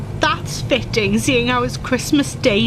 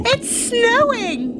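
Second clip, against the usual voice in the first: delighted wonder; 9 semitones higher, slower than usual. Acted. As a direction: savour the first snowflakes. Snow starts falling, daughter exclaims.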